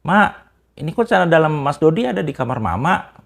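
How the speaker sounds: loud, high mock yell from outside the door; retold shout. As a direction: imitate a wife calling out a startled question. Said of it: "Teriak tuh dari, dari luar pintu kamar".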